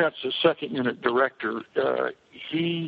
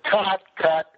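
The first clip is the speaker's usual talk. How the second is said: higher-pitched mock director's call; mild, pitched-up holler to the crew; retold calling out; 7 semitones higher, 4 dB louder than usual. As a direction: re-enact calling a halt to filming. Director calling a halt on set.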